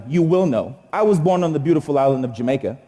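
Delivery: ordinary delivery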